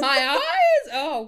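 high, loud laughing cry of pain; laughing mock shriek. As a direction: squeal it through laughter.

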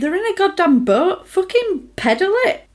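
high-pitched mock exasperation; exasperated viewer. Co-host recalls reacting to the stranded crew, exasperated and high-pitched. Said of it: "But I was like".

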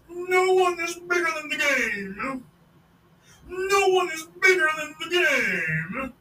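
wag a finger and shout it. Retold or imitated. Mocking purists' scolding.

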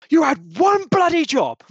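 high, loud yell of blame; exasperated scolding. Acted. Boat pilot turns on the players, exasperated shouting. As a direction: berate the useless crew at full pitch. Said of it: "turns around to you guys and goes".

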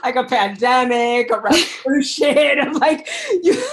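high, loud talk through laughter; giggling about getting a pandemic and a revolution; laughing. Real, not acted. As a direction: laugh your way through the words. Laughing while talking.

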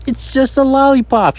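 it's nothing, why the fuss; exasperated outburst. Real, not acted. Exasperated at angry partner.

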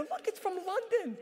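high-pitched mock delight; excited discovery. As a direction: show off an amazing find to a kid. Dad delighted by talking toy.

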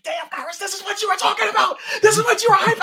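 very high, loud, laughing hype; amazed shout. Pretend. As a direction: scream it like a thrilled fan. Imagined fans' amazed reaction.

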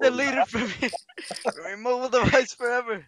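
high mock plea. Mock pleading.

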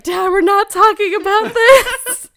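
high, loud, slowed, laughing protest; embarrassed protest. Retold or imitated. Daughter stopping her dad's safe-sex talk, laughing in embarrassment. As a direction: cringe and shut it down through laughter.

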